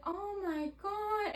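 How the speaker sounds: slow, high movie-scene imitation; drawn-out, high, tight-mouthed mimicry; mouth barely moving. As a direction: say it without moving your mouth. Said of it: "not moving her mouth".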